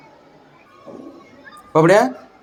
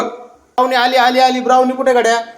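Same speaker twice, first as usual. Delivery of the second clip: higher sing-song calling; sing-song pet call; 8 semitones higher than usual. Real, not acted. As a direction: call the dog playfully.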